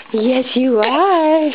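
slow, high cooing praise. Real, not acted.

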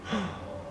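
high startled gasp; gasp of suspense. Pretend. Building suspense for children, gasping. Suspense before tree climb.

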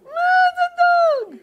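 very high, drawn-out falsetto exclamation; mock amazement. Preacher mocks fisherman turned miracle worker, mock amazement. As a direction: squeal it slowly in hammed-up wonder.

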